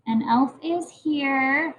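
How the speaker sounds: slow, word by word; slow reading aloud. Shown as a demonstration. Teacher rereading the sentence slowly to the class. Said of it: "add more to my sentence".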